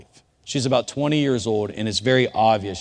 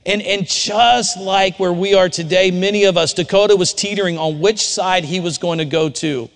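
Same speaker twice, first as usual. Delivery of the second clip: preaching at full force; 7 semitones higher, 5 dB louder than usual. Acted. Sermon application at full force.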